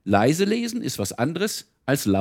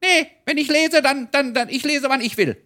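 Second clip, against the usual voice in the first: very high, squeaky cheeky creature voice; Sams voice; 3 dB louder than usual. Retold or imitated. Reader voicing the Sams for the children, squeaky high defiant voice. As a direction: pitch way up like a defiant little Sams. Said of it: "Ein Sams sagt zum Beispiel".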